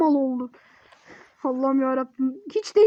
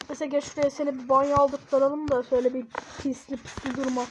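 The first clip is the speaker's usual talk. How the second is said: normal talking voice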